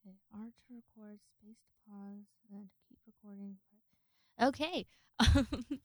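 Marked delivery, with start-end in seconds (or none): 0.1-3.6 soft whisper, reading the buttons to herself. Real, not acted. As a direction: whisper the steps under your breath.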